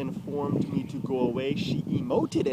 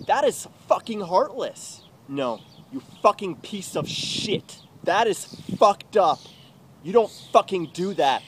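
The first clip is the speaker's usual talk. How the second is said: slow, loud, punched-out outrage; furious rant; 6 semitones higher, 7 dB louder than usual. Acted. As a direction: let each curse land hard and loud.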